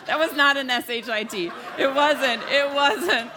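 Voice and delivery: loud, laughing protest. Real, not acted. Preacher denying she swore, laughing loudly.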